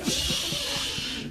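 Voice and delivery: put-on hissed hush in African accent; long shush, then whisper it tensely; stage whisper. African hunter warning of a lion, hushed stage whisper.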